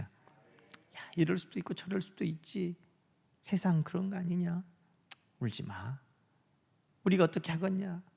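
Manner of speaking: soft fatalistic friend's comfort; resigned comfort. Retold or imitated. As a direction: mumble a weary there-there. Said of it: "이런 위로가 아니에요".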